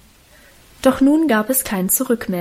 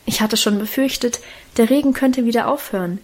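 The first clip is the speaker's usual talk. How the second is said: ordinary delivery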